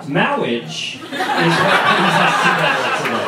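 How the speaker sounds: slow, ceremonial mock-wedding officiant; comic clergyman voice. Speaker opening a talk on marriage, comic pompous clergyman voice. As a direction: stretch out the opening word with mock solemnity.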